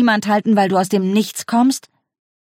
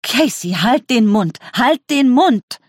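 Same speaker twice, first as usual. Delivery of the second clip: rising, louder and higher to the last Mund; urgent shout; 5 semitones higher, 3 dB louder, slower than usual. Acted. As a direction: cut her daughter off, shouting. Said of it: "Leia rief auf Koreanisch".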